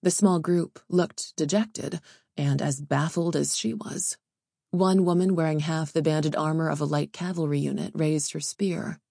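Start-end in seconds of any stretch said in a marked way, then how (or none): none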